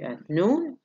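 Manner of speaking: noon rises up high. Shown as a demonstration. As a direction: lift noon at the end. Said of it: "noon is the rise". (صعود على noon)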